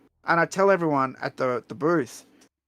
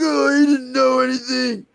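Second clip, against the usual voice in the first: high, loud dismissive shout; boss shouting; 10 semitones higher, 6 dB louder than usual. Retold or imitated. Boss dismisses Ed Boon to his face, loud scornful shout. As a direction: bark it out like the angry boss. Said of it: "And he's like".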